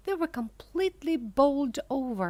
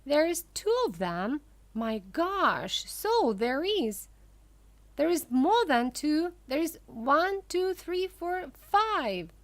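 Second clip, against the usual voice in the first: excited shouting; 5 semitones higher, 3 dB louder than usual. A narrator's reading. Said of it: "everybody started shouting at once".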